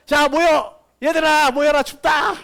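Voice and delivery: loud, high rallying call; penguin calling the flock. Retold or imitated. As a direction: shout for everyone to huddle up. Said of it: "해가지고".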